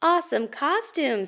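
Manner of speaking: high, impressed compliment; delighted admiration, lifted high; admiring Pete. Acted. Pete admires costume in story.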